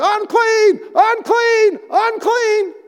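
high, loud calls of "Unclean"; leper calling out. Retold or imitated. Outcast leper warning people away with high, loud cries. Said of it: "walk around".

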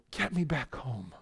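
low, soft homesick plea; quiet plea. Acted. Psalmist asking God to lead him home, low hushed plea. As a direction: drop right down and plead.

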